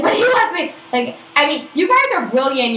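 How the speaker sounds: very high, loud mock whine of insistence; mimicked protesting friend. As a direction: protest in a silly high voice, mocking her.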